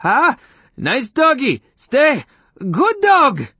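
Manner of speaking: very high, loud frightened pleading; squeaky, panicked begging; shrill pleas. A narrator's reading. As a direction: placate a menacing dog in a shrill panic. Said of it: "shrill pleas a couple of octaves higher".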